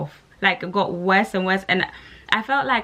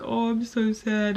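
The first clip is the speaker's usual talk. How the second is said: mock self-pity; 4 semitones higher, 4 dB quieter than usual. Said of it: "It was just like".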